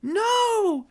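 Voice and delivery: very high, loud no; horrified exclamation. Acted. Mary Ann protesting the order to shoot, crying out. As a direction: cry it out in alarm. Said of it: "exclaimed Mary Ann".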